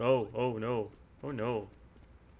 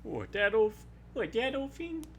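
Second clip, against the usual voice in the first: put-on higher, folksy old miner voice; old prospector; 11 semitones higher than usual. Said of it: "Why does it sound like an old prospector?".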